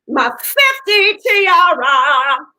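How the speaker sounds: slow, stretched-out showy announcement; grand flourish. Real, not acted. Host thanks friend for her birthday tiara, drawn-out flourish. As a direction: draw each word out grandly.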